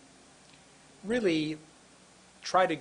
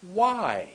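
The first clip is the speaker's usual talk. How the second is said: WHY swooping high, long; drawn-out word; 4 semitones higher, 4 dB louder than usual. Shown as a demonstration. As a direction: stretch WHY out with a big pitch arc. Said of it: "Made that word so long".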